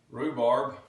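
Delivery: "Rhubarb" with rounded R; loud American "Rhubarb", full R; Texan pronunciation. Shown as a demonstration. Teacher demonstrating American, "Rhubarb" with rounded R. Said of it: "Another rounded R".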